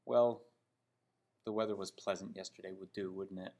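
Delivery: ordinary talk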